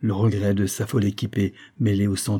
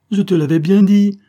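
high, loud wail of reproach; plaintive moan; 10 semitones higher, 9 dB louder than usual. A narrator's reading. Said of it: "gémit Céline".

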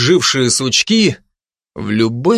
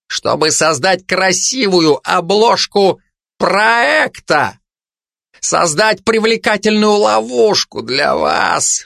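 raised-pitch, insistent oratory; impassioned denunciation; 6 semitones higher than usual. Acted. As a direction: harangue the crowd, stretching key words. Hermit denouncing the project to listeners. (обличительно, с пафосом)

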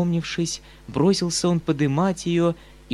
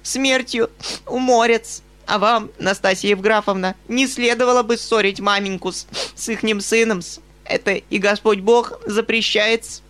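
high, loud scolding; spiteful companion; 8 semitones higher, 4 dB louder than usual. A narrator's reading. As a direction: rebuke them with pious venom.

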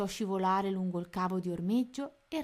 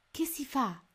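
higher, small worried voice; nervous question; 6 semitones higher than usual. A narrator's reading. Little mouse waiting by the moat, nervous. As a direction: ask it with jittery nerves. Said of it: "che non era per niente tranquillo".

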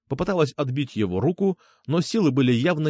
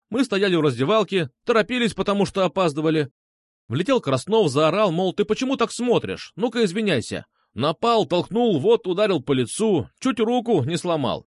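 higher, hurried run of accusations; rattling off; 6 semitones higher than usual. A narrator's reading. Marat accuses Krasnov to teacher, rattling off. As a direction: rattle off the complaint item by item. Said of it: "затараторил тот".

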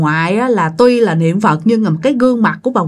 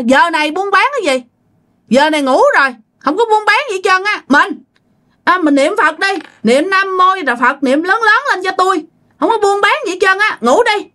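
raised, higher voice calling out; loud grandfather; 10 semitones higher than usual. Retold or imitated. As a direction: speak up loudly so the ghost hears. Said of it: "ông ngoại mới nói lớn". (ông ngoại nói lớn, giọng cao hơn)

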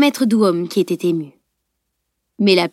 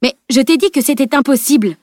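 higher-pitched, aghast protest; choked disbelief; 5 semitones higher, 4 dB louder than usual. A narrator's reading. Analyst sees the impossible done, spluttering. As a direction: splutter it in shocked reproach. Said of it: "hoqueta l'analyste".

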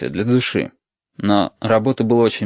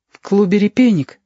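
put-on high little boy's question; high-pitched, curious interjection; curious child; 10 semitones higher, 3 dB louder than usual. A narrator's reading. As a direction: pipe up suddenly in a child's voice. Young boy suddenly pipes up.

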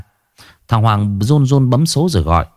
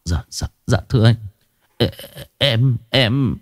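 stammering; 3 dB quieter than usual. Acted. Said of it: "nó mới lắp bắp".

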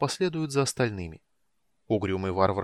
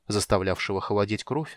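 usual speaking voice

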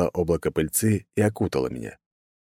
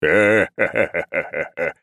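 drawn-out ha-ha-ha belly laugh; slow hearty laugh; much slower than usual. A narrator's reading. God laughs at his son's cunning, slow and hearty. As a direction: laugh in slow, separate beats. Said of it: "рассмеялся Урфин".

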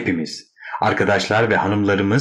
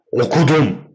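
raised, exasperated yell; angry shout; 5 semitones higher, 4 dB louder than usual. A narrator's reading. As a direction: shout it back at your sister. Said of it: "diye bağırıyordu". (öfkeyle bağırarak)

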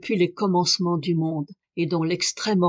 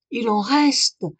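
higher, louder excited cry; 4 semitones higher, 5 dB louder, slower than usual. A narrator's reading. Duncan cries out to Clarence about the manuscripts, excited. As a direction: blurt it out as a thrilling discovery. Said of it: "cria-t-il".

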